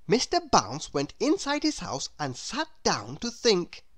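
usual speaking voice

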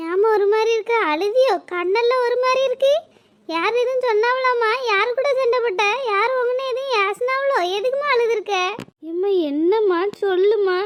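put-on a kid asking mom why she cried; children asking their sad mother what happened; worried child. Children worried about crying mother.

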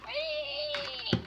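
high, soft drawn-out whee; tiny high-pitched cry of glee; playful whee. Pretend. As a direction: squeal a small, high whee. Toy flung about in play.

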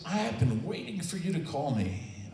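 mildly imitated eager caller; lightly voiced Ted. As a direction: lift the voice a little for the odd friend.

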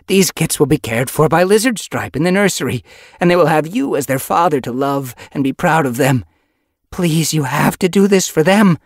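pleading she-cat. Acted. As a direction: beg him for the kits. Yellow Fang pleading.

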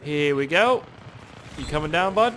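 normal talking voice

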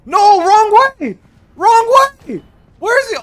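high loud slow panic yell; panicked yelling. Real, not acted. Friend running wrong way.

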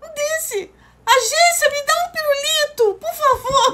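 gushing high thank-you voice; excited high voice. Real, not acted. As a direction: squeal your love for the gift. Gushing over gift, high voice.

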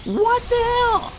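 high, drawn-out startled yelp; startled outcry. Real, not acted. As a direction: blurt it out in shock, pitch shooting up. Startled by something in the game.

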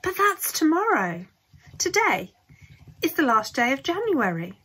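high, agitated mother's voice; alarmed. A narrator's reading. Mrs Bucket telling the family time is short, high and urgent.

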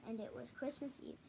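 ordinary delivery

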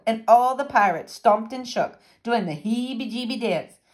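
plain reading voice

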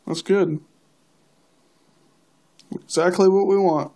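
ordinary talk, nothing marked

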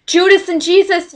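high, loud fangirl squeal of names; gushing fan. Retold or imitated. Fan greeting the actors after the show, gushing. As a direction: call out to your idols, starstruck.

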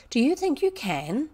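high-pitched amazed question; exclaiming. A narrator's reading. Girl doubting her clever friend can, exclaiming. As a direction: ask in hopeful astonishment. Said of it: "exclaimed Ermengarde".

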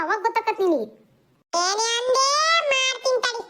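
put-on squabbling kids. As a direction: play kids trading insults and pulling hair. Kids quarrel, hair pulling.

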